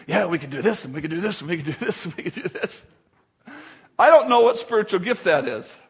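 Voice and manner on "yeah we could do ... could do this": lightly voiced eager planner; mock stream of ideas, tumbling out; Kim brainstorming. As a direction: rattle off ideas in a low, repetitive run. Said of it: "she gets all fired up about that".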